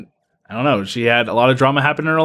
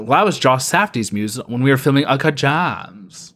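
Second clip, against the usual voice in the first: normal talking voice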